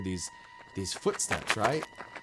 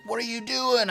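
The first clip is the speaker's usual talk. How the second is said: high, lightly voiced kid asking; imitating his son; 13 semitones higher, 4 dB louder than usual. Son on FaceTime, high incredulous kid voice. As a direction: squeak it like a worried young son.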